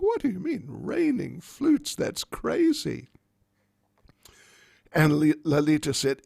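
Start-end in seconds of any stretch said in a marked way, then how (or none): 0.0-3.1 can't believe the absurd claim; shocked old Jatila. Acted. Jatila reacts to Lalita's raining-flutes claim, high and incredulous. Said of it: "Jatila was in shock".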